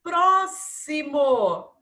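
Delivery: "Próximo" loud and slow, pro-si-mo. Shown as a demonstration. Teacher showing pupils the SS sound, "Próximo" slowly sounded out. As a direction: stretch "Próximo" with a clear s. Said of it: "Som de S S".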